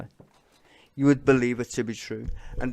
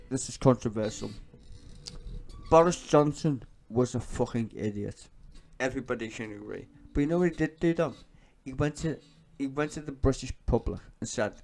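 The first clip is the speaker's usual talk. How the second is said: normal talking voice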